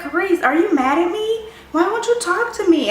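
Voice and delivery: high whiny plea; imitating worried friend. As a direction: plead for an answer. Friend pleading with silent friend.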